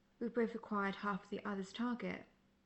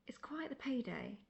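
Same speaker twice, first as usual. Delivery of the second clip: whispered, pitched up, savouring it; gleeful hushed aside about gold; conspiratorial whisper; 4 semitones higher than usual. Acted. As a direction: whisper it like a secret treasure. Hunter gloating over bounty money.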